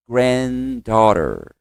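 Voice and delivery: Granddaughter stretched slowly as grand-daw-ter; slow pronunciation demo. Shown as a demonstration. Teacher drills class on Granddaughter, very slow model. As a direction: drag out Granddaughter syllable by syllable. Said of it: "De novo".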